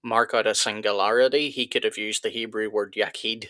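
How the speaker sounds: normal talking voice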